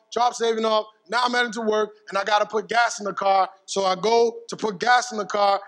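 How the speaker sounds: ordinary delivery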